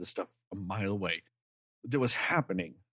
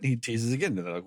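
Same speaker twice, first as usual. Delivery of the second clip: ordinary delivery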